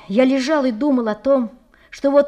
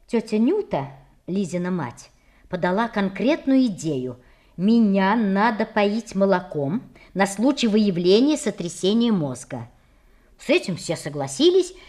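usual speaking voice

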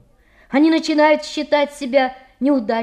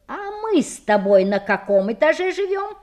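higher-pitched reproachful question; 6 semitones higher than usual. A narrator's reading. Boy detective reminding his friend where they live, reproachfully. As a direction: chide with a pointed, raised-pitch question. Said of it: "укоризненно спросил Шерлок Холмс".

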